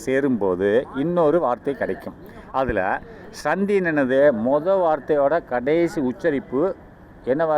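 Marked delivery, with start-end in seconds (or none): none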